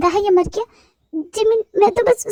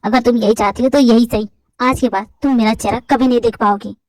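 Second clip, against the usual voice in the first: put-on lower, insistent young man demanding an answer; angry young man; 8 semitones lower than usual. Boyfriend confronting girl about the kiss, lower insistent male voice. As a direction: press her for the truth.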